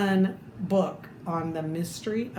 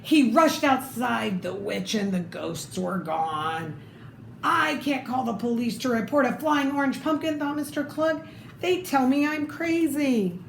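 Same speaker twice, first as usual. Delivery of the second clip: lively read-aloud storytelling voice; story narration; 3 semitones higher than usual. Acted. Reading a picture book to children, lively storytelling.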